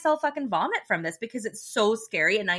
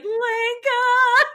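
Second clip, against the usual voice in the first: high sung-out call of Lenka; worried boyfriend calling through the door, high and long; calling out a name; 14 semitones higher, 5 dB louder than usual. Retold or imitated. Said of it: "and he's like".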